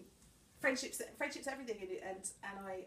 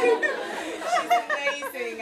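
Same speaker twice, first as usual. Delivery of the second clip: high, slow words on a laugh; 16 dB louder, much slower than usual. Real, not acted. Speaker to guests about her sister, laughing. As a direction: gush through the laughter.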